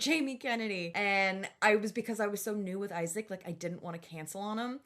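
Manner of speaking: ordinary delivery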